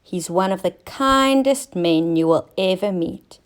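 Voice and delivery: ordinary delivery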